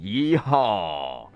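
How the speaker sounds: low Texan cowboy yeehaw. Retold or imitated. Streamer closing the Texan mock with a low yeehaw. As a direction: drawl it like a cowboy. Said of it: "you talk like a Texan".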